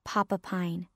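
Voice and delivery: plain reading voice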